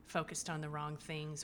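ordinary delivery

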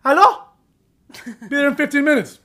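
high-pitched mock Mexican-accented phone voice; Miguel on the phone. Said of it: "So then Miguel Toast calls".

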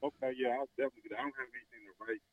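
distant, faint phone voice; speaking away from the mic; far from mic. Real, not acted. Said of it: "You sounded like you're far away".

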